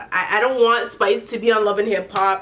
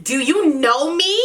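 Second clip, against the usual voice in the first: imitating a defiant challenge; 5 semitones higher than usual. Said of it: "Jasmine gets bright and Jasmine's like".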